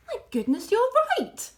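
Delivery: surprised cry. A narrator's reading. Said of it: "cried Mister Fox".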